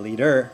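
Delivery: "leader" hit on the final "DER"; second-syllable stress. Shown as a demonstration. Coach imitating Australians seeing that R, stress on end of "leader". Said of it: "they'll stress like an Australian".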